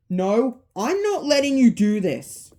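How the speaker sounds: high, loud protest; defiant protest. Acted. Abby tries to stop the animatronics, defiant shout. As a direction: cry out in firm refusal.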